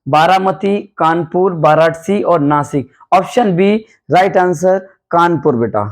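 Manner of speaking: ordinary talk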